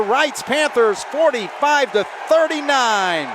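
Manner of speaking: high, loud victory shout; excited championship call. Real, not acted. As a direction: belt out the winning call. Final buzzer, championship call.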